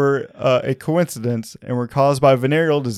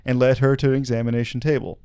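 ordinary talk, nothing marked

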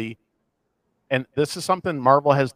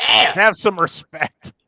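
slow, louder, raised mock reprimand; mock indignant; 4 semitones higher, 6 dB louder, much slower than usual. Host chiding co-host about [name], mock indignant. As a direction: scold with put-on indignation, drawing it out.

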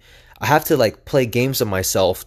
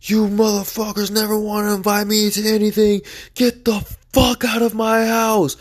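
imitating a drunk father's furious high-pitched yell; mock his rage, pitch jumping way up; drunken yelling; 11 semitones higher than usual. Drunk dad raging at his family, imitated high furious yell. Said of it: "and it came in yelling".

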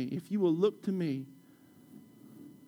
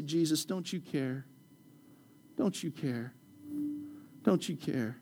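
desperate plea; 7 dB quieter than usual. Acted. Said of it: "they're screaming at him".

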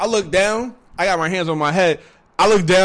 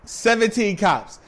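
normal talking voice

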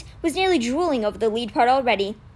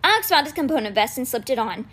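plain reading voice

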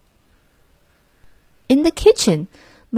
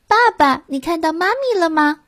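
put-on child voice; 9 semitones higher than usual. A narrator's reading. Mouse asking father.

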